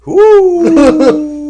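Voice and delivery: loud high whoop, then a laugh; woo and laugh. Real, not acted. Rapper whooping and laughing at the question about his younger self.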